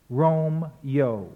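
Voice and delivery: "Rome-yo" in two syllables, ending on a gliding "yo"; two-syllable Romeo. Shown as a demonstration. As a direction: repeat "Rome-yo" firmly with merged final vowels. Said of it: "to two syllables".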